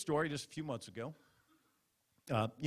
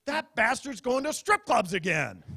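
higher, louder indignant wife; suspicious wife; 9 semitones higher, 8 dB louder than usual. Retold or imitated. Wife realises her husband goes to strip clubs, indignant higher. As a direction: spit it out like a wronged wife. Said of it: "going to go through your mind is".

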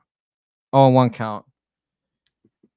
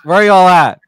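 taunt; 5 semitones higher, 6 dB louder than usual. Real, not acted. Repeated taunt of Tigers fans.

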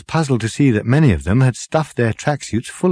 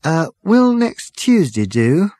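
slow, higher, hesitant question; sheepish; 8 semitones higher, slower than usual. A narrator's reading. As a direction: sheepishly float a feeble excuse. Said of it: "said the hairdresser with a sheepish look".

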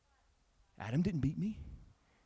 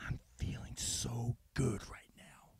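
whispered gloat; 2 semitones lower, 7 dB quieter than usual. Acted.

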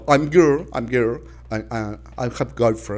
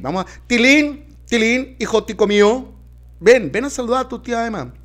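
put-on calling the boy; 10 semitones higher than usual. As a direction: call the kid over from the next room. Calling the child.

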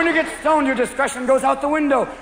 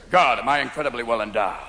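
pompous cop, pitched way down; deep, self-satisfied policeman voice; motorcycle cop; 9 semitones lower than usual. Acted. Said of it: "you're stopped by a motorcycle cop".

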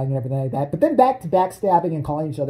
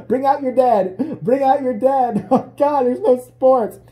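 slow, high mock call of a crier; plague crier; 8 semitones higher, 5 dB louder, slower than usual. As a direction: call it out like a medieval plague cart.

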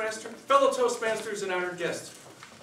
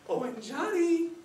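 much higher calling mom voice; mother's voice; 12 semitones higher, slower than usual. Acted. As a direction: call him awake like his mother. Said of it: "I heard my mother knocking".

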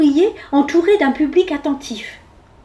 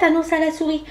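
ordinary delivery

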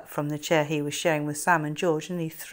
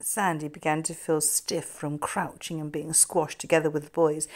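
ordinary delivery

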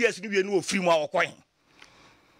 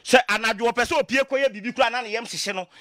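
ordinary talk

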